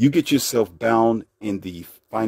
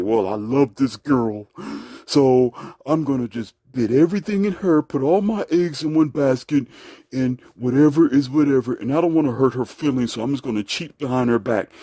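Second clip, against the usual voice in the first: mocking a naive man; 4 semitones higher than usual. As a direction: play the lovesick fool. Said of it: "you're dumb enough to say".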